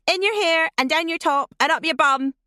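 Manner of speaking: put-on a girl jeering at her friend in the box; taunting yell. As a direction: shout the taunts with delight. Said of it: "then burst out laughing".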